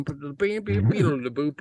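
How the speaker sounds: usual speaking voice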